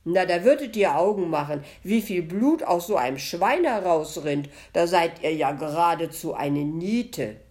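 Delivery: loud, scolding butcher; tadelnd. A narrator's reading. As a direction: chide the ghost at full volume. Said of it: "rief er tadelnd". (lautstark tadelnd)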